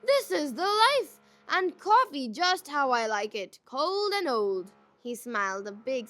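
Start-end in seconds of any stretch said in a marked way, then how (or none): 0.0-4.7 loud, gushing fake pleasure; mock delight. A narrator's reading. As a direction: rave about it, beaming. Malice gushes over bad coffee.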